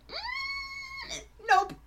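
high cracking scared squeal. Retold or imitated. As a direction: panic and back away fast. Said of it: "I'm like".

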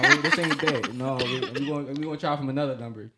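half-laughing with excitement; excited laughing. Real, not acted. Excited retelling of texting.

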